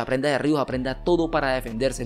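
ordinary delivery

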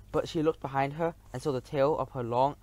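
usual speaking voice

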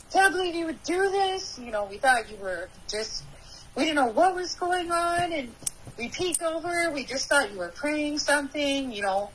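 high, breathless retelling of a frantic friend; mock frightened woman, high and rushed; panicked friend. Friend's panicked words retold.

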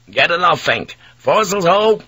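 shouted orders. A narrator's reading. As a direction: call it up into the rigging. Captain calling orders in storm.

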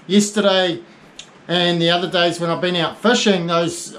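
ordinary talk, nothing marked

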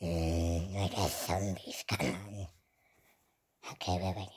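low, soft zombie voice; lurking undead murmur. Pretend. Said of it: "What happened to the zombie voice?".

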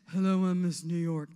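loud, low pageant introduction; put-on, projected beauty queen voice; generic pageant queen. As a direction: intone it like a polished Miss America contestant. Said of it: "She said".

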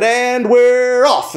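high, loud, drawn-out announcer cry; race start call. Retold or imitated. Announcer starting the pony race, long high shout. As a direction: launch the race like a track announcer. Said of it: "And he said".

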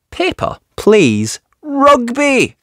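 high slow title read; title announcement. Shown as a demonstration. Host announcing the story title, high and slow. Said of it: "Peppa Pig".

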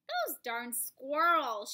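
slow, loud grumpy shout; angry shout. Acted. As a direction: yell it out like a cranky old man. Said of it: "shouted Fookwire".

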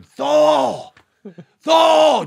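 loud high yell to the sky; shouting Thor. Retold or imitated. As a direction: bellow the name at the storm. Shouting at lightning in sea.

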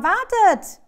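high, loud drawn call. A narrator's reading. Grandma calls after child and dog, loud shout. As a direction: shout for them to stop. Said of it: "ruft Oma".

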